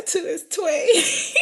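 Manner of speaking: high, loud, stretched "twee" for "two"; amused, drawn-out "twee", like "tway"; imitated Memphis pronunciation. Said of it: "how do they say".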